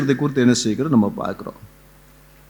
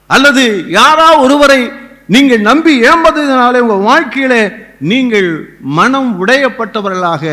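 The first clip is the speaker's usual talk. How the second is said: impassioned preaching; 6 semitones higher, 4 dB louder than usual. Real, not acted. Preaching at full force.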